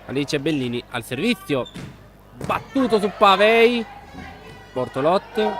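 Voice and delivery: quick live match commentary; play-by-play. Real, not acted. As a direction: call the rally as it happens. (telecronaca sportiva dal vivo)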